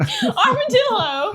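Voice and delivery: laughing. Real, not acted. Calling friend while laughing.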